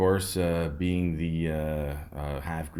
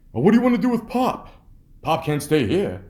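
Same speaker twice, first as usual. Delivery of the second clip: much higher and louder, fast, hammed-up worried relative; mock fussing relative; 12 semitones higher, 9 dB louder than usual. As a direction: squeal it like a flustered family member deciding Pop's fate. Family talking about old Pop.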